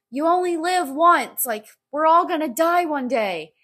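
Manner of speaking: high, loud, elated cheer; so happy. Acted. Voicing the meme's sunshine-and-rainbows guy, happy. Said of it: "and he's so happy".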